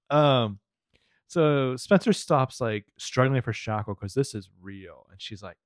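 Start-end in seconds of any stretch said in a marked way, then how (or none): none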